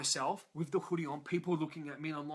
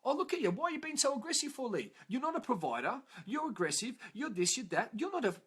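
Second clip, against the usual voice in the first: goading challenge, pitched up; retold confrontation; 7 semitones higher than usual. Mentor challenges student in front of class, goading reproach. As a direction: imitate a mentor needling with rapid accusations.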